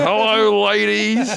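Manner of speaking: mock pickup line. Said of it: "it would be like".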